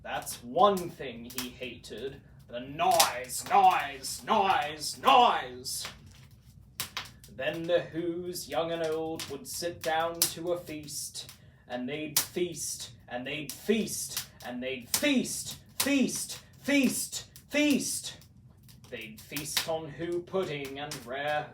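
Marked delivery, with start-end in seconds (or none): 2.5-5.9 louder, pounding each noise; loud repeated noise. A narrator's reading. The Grinch's hated noise, punched loud. 14.4-18.1 climbing higher on each feast; rising feast. A narrator's reading. Whos feasting on and on, voice climbing higher. Narrator building feast repetition.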